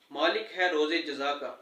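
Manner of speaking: normal talking voice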